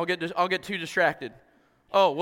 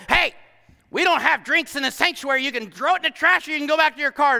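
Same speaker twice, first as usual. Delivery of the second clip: higher, faster scolding usher; mock a fussy church usher, fast; bossy usher; 8 semitones higher, 6 dB louder than usual. Usher scolding a newcomer over a drink, mock bossy. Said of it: "all of a sudden".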